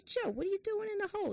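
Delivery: high, quick, incredulous question; puzzled calling down. Acted. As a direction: act out a baffled friend calling down. Friend calls down, puzzled, in joke.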